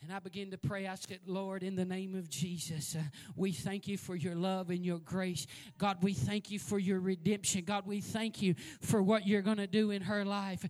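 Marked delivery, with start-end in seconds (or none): 1.3-10.6 rising, louder prayer voice, building to fervour. Acted. Preacher praying over the phone for the woman, growing louder. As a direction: pray aloud for the troubled woman, building in fervour. Prays aloud in sermon.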